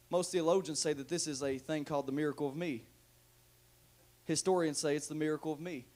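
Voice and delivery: ordinary talk, nothing marked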